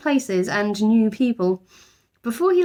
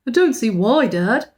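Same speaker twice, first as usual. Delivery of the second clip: put-on louder, boyish protest; Jonathan crying out; 4 semitones higher, 4 dB louder than usual. A narrator's reading. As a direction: object eagerly like a boy. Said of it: "cried Jonathan".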